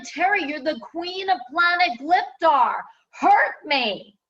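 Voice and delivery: high, loud, tearful plea; pleading command. Retold or imitated. Client begs the queen of Gliptar to hurt him. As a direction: beg her to hurt him, grandly.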